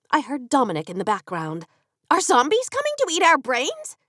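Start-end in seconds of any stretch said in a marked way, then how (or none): 2.1-4.0 put-on higher, eager child's question; thrilled at the idea; enthusiastic boy. A narrator's reading. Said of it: "He sounded enthusiastic about the thought".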